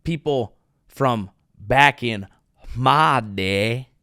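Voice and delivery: ordinary delivery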